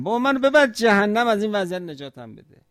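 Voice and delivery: high, pleading cry of a shamed soul; pleading sinner. Acted. Shamed sinner pleads with God, high desperate cry. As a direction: beg loudly to be taken to hell, desperate. Sinner begs to be taken away.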